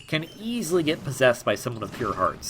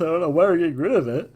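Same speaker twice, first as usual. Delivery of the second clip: yawning; 4 semitones higher, 4 dB louder, much faster than usual. Real, not acted. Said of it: "sorry for the yawn there".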